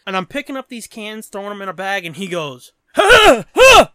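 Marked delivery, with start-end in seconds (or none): 3.0-3.9 imitated grunting, high and forceful; two explosive grunts; loud mock grunts. Junker accosting someone picking up cans, loud imitated grunting. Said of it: "Starts grunting at me".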